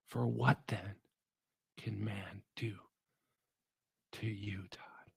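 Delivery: slow unvoiced whisper; whispered assurance. God to Todd, slow whispered assurance. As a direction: breathe it slowly, close.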